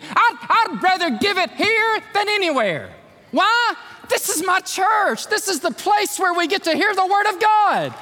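preaching at full force. Acted. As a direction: shout your love for the church.

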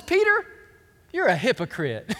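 high, loud call-out of the name, then dropping; acted indignant accusation; ticked-off rebuke. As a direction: confront him in mock outrage. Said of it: "he's pretty ticked about it".

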